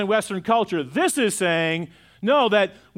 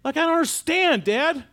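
higher, pleading son's disbelief; exasperated son; 8 semitones higher than usual. Retold or imitated. Son on the phone to his dad, higher and exasperated. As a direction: press the question like a bewildered son. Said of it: "he goes".

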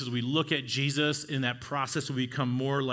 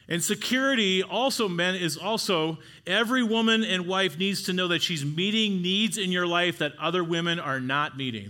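higher, louder pulpit drive; preaching at full force; 5 semitones higher, 4 dB louder than usual. Acted. Pastor telling men to never threaten leaving, full-force preaching. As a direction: hammer the promise home. Security point, preaching harder.

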